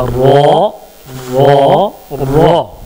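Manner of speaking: रो with the tongue curled up to the tooth roots; letter demo. Shown as a demonstration. Teacher showing the class the letter, curled-tongue r. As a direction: curl the tongue and tap out रो. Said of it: "तो रा निकलेगा".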